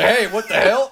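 high-pitched, loud-ish comic outcry; mock alarmed protest. Cid protesting as ghosts whip him into space, mock alarm. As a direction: cry out in mock bewilderment.

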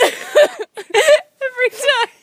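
very high, slow, stretched laugh-cry; helpless laughter squeezed out; laughing till crying. Real, not acted. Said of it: "I'm sobbing".

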